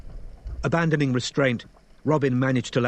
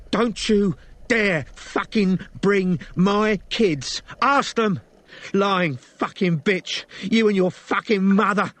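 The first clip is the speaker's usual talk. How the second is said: furious scream; 10 semitones higher, 4 dB louder, slower than usual. Acted. Elisa screaming at Robin.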